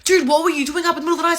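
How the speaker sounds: fast, high-pitched retold demand; mock indignant questioning. Sister demanding why brother was up, mock indignant.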